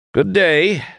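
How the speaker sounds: high, clipped, final goodbye; curt farewell. A narrator's reading. Doremus dismissing the plotters with a cold goodbye. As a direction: end the meeting with a cold send-off. Doremus curtly takes his leave.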